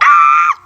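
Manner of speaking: sudden high scream of frustration; frustrated scream. Real, not acted. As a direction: let out a shrill burst of rage. Venting anger at the news.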